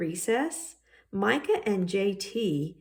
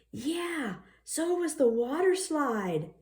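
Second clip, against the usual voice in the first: voiced Fiona; 9 semitones higher than usual. Fiona chiming in.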